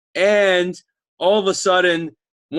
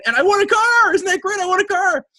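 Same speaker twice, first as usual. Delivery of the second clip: much higher, louder gushing; gleeful boast; 3 dB louder than usual. Acted. Guest tells host he won a car, squealing with glee. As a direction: crow about the car, thrilled.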